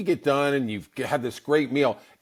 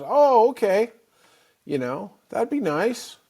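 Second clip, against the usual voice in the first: mom voice; 9 semitones higher, 3 dB louder than usual. Retold or imitated. Mom's voice in retold story.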